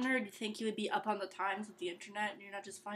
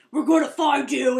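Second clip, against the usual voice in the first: loud menacing yell; threatening shout; 3 semitones higher, 14 dB louder than usual. Retold or imitated. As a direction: bellow it like a thug. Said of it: "And be like".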